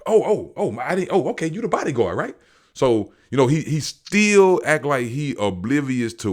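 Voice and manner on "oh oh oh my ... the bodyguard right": much higher, stammering mock panic; flustered backpedal. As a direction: backpedal fast when the bodyguard stands up. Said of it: "he was like".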